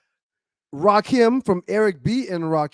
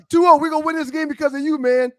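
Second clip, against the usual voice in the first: higher, quick pep talk; excited hype; 8 semitones higher than usual. Retold or imitated. Fan telling Barry Sanders he will win the game, excited hype. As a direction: hype up the star player. Acts out hyping the player.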